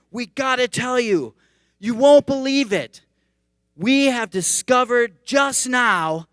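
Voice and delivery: high, urgent mock news bulletin; loud, stretched-out breaking-news hype; breaking-news announcer. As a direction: play an over-excited newscaster with a big scoop. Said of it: "no one ever gives news like that".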